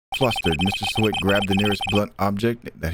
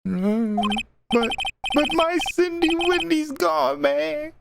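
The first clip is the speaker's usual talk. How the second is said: very high, slow mock wail; squeaky drawn-out melodramatic grief; hammed-up blubbering; slower than usual. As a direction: wail as a heartbroken sad sack. Voices Larry grieving lost girlfriend.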